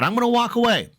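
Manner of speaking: mild retold scream, pitched high. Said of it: "I'm screaming".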